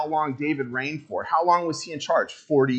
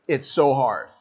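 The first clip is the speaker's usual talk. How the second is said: ordinary talk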